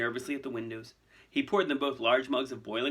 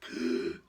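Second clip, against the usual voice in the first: sudden sharp gasp; audible gasp; 6 semitones lower than usual. A narrator's reading. Harry gasping at the new visitor, audible gasp. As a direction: gasp aloud in shock. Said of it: "He'd let out an audible".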